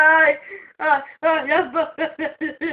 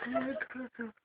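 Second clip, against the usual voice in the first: barely audible, hushed plea; whispering while hiding; 7 semitones lower, 20 dB quieter than usual. Real, not acted. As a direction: whisper it low. Quiet pleading during the pulling.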